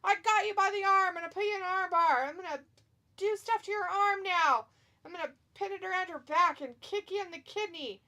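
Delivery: high, loud mock struggle and threats; play-acted wrestler. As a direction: voice the wrestler's moves as excited trash talk. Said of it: "He's like".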